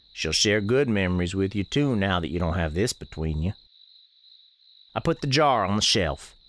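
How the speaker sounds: normal talking voice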